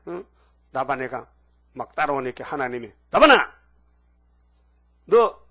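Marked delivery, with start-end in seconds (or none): 3.1-5.4 much higher, much louder furious shout; God shouting. Acted. God yelling at Laban to keep his hands off Jacob, full shout. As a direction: roar it as God scolding Laban.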